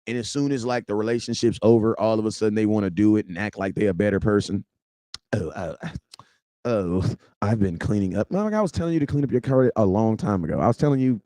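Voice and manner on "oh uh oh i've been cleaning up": lower, softer mock ex excuse; dopey voice for the ex; mocking an ex who claims to have changed, lower and softer.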